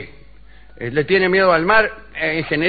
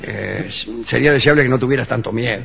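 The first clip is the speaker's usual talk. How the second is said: lightly voiced gentle father; fatherly voice; 6 semitones lower than usual. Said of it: "Estás cambiando la voz, papá".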